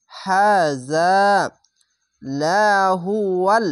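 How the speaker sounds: slow chanted হাজা লাহুয়াল with stretched vowels; Quran recitation. Shown as a demonstration. Teacher models the recitation for students, slow chant. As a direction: draw out each syllable as in tilawat. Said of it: "লাম জবর লা".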